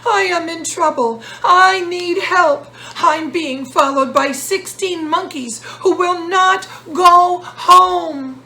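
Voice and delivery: loud, high and slowing on I need help; frantic wailing to his wise friend; desperate plea. Acted. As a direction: beg for help, near panic. Peddler wails for help.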